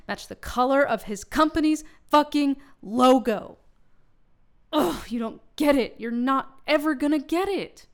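rising into a furious rant; angry outburst. Acted. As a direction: blow up at him, loud and high. Bride ranting about fiancé's father.

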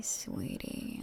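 normal talking voice

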